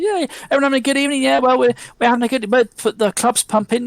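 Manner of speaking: high, fast, breezy pub patter; chatty landlord. Acted. Landlord chatting to his guests, high and fast. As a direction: rattle off cheery host chatter.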